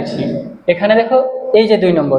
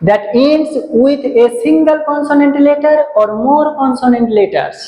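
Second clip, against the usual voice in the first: slow, careful definition read; teacher dictating a definition, slow and clear; slow dictation; 4 semitones higher, much slower than usual. Shown as a demonstration. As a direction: read it out slowly for students to copy. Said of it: "লেখছে".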